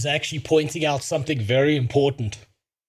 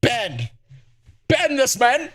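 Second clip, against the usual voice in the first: mock outrage; 9 semitones higher than usual. Mock demand to ban streamer.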